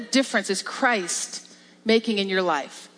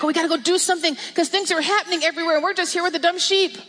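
higher, fast, restless griping; frustrated complaining; 5 semitones higher, faster than usual. Retold or imitated. Mocking a believer stuck with dumb sheep, fast impatient complaint. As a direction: whine like someone itching to leave the field. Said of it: "You don't have to be all frustrated".

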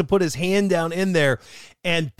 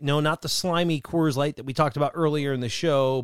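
usual speaking voice

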